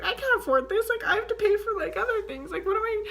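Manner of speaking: high, teary laughing panic; half-laughing, half-crying about the money; getting emotional. Retold or imitated. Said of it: "getting emotional over it".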